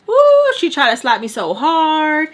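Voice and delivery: loud, drawn-out storyteller emphasis; emphatic exclamation. Real, not acted. Storyteller recalling grandma's attempted slap, emphatic exclamation. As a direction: exclaim it slowly and loudly, stretching the key words.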